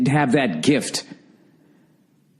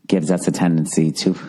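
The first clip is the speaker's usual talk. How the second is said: put-on soothing British accent; 8 semitones lower than usual. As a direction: imitate a calm British guide, much lower. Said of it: "soothing voice like this, some sort of British accent".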